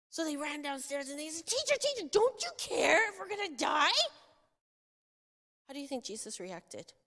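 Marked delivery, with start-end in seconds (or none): 1.5-4.0 desperate plea. Acted. Disciples wake Jesus.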